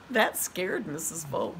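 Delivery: plain reading voice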